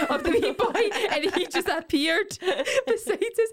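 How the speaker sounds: can barely get it out for giggling; laughing. Real, not acted. Laughing while telling funny story.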